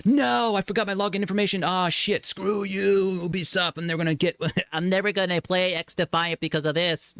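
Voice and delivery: high, whiny fed-up gamer; a locked-out gamer cursing Ubisoft, high and whiny; frustrated gamer. Retold or imitated. Forgot his login and rages at Ubisoft, high whine.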